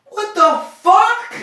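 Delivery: high, loud, slow squeal of disbelief; squealed outrage. Real, not acted. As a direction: shriek it in disgusted disbelief. Outraged squeal at lazy sequel.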